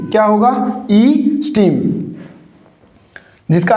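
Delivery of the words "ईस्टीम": ईस्टीम with a long opening "ee", falling at the end; corrected pronunciation. Shown as a demonstration. Teacher repeating the correction for students, ईस्टीम with long "ee". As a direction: say ईस्टीम as "ee-steem". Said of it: "इसका correct pronunciation क्या होगा".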